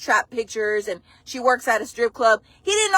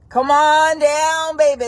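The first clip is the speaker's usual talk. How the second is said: slow, loud drawn-out call; come on down call; 4 semitones higher, 4 dB louder, slower than usual. Real, not acted. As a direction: call her down like a game show host. Calling the woman out on live.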